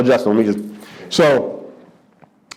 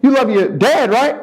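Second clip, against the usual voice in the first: high, pushy know-it-all preaching; hammed-up Patrice selling his philosophy; mock Patrice lecturing; 11 semitones higher than usual. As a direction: Patrice preaching love to the young comic. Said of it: "He was like".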